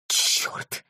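hushed, voiceless swear; startled whisper; whispered curse. A narrator's reading. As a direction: curse under your breath, startled by a bang. Startled by bang during secret break-in.

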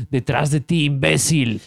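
lightly imitated Mexican accent; quick hint of a Mexican accent. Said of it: "hablaban con acento mexicano".

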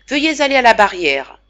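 stern command to go to the barrier. A narrator's reading. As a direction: bark it like an impatient second. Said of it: "me dit sévèrement Koloberdaev".

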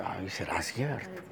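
low, soft doubting aside; imitated doubt. Pastor voicing a listener doubting the story, low and soft. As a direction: wonder it under your breath, as an imitated doubter. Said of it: "uno dice".

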